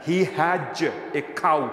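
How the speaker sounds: He has a cow said very slowly, has ending on a buzzing z; teacher slowly showing the z in has; very slow demo. Shown as a demonstration. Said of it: "এটার মধ্যেও কিন্তু জ আছে".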